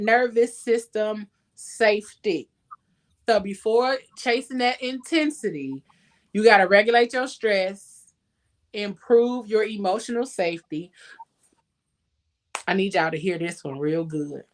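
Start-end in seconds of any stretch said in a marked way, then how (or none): none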